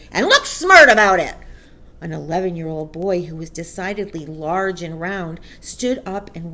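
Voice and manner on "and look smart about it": loud, impatient command; shouted order. A narrator's reading. Headmistress hurries a boy to the platform, shouted. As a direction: shout it to hurry someone along. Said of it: "the Trunchbull shouted".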